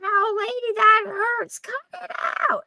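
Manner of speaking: high screechy whiny stooge voice; hammed-up squeal of a hurt stooge; Curly from the Three Stooges. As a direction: yelp like Curly getting punched. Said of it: "it sounds like Curly from the Three Stooges".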